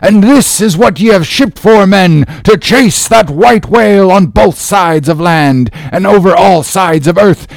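high, loud, impassioned rallying cry; rally the crew with a vow of vengeance; shouted out. Acted. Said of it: "he shouted out".

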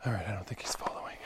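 fast nervous whisper. Real, not acted. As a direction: whisper so he won't hear. Hiding from follower.

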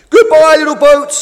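loud, high send-off cheer. A narrator's reading. Boats wish the new boat farewell, cheering loudly. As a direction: cheer at the top of your voice. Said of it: "they cheered".

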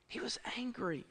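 whispered for emphasis; hushed gravity. Real, not acted. Preacher repeating the verse on the brother's anger, hushed gravity. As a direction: drop to a grave whisper.

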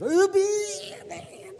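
high, loud imitated child's panic; hammed-up girl's panic; mock panic. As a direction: squeal like a frightened girl. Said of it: "a bee goes".

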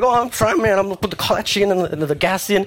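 high, strained learner-driver panic; flustered panic. Retold or imitated. Stalled learner pleads over honking, high and frantic. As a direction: sound swamped and frantic.

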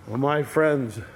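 ordinary talk, nothing marked

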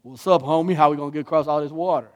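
put-on quick street-slang accent; put-on homie accent. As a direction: do a playful mock homeboy accent. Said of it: "I'm always picking on that accent".

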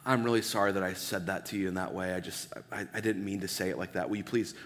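put-on soft, hushed and quick, saying sorry to your kid; a parent softly taking back harsh words; gentle apology to a child. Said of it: "you have to go back and say".

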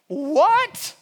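high mocking taunt; mocking Goliath. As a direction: scoff at the little shepherd from way up. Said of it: "That's what Goliath is saying".

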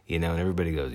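ordinary delivery

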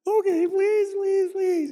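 high whiny pleading, hammed up; mock groveling beggar. Preacher mimics a sinner begging God, high whiny pleading. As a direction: beg like a groveling sinner on his knees.

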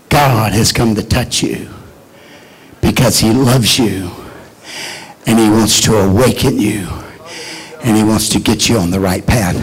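low, soft, tender preaching; soft altar call. Acted. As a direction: draw them in gently and low.

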